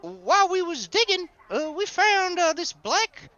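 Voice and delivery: high, eager, folksy storyteller; chatty, excitable Gus. Acted. Rancher telling strangers about a discovery, eager and folksy. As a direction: tell it excitedly, pitch lifted throughout. Game master plays Gus.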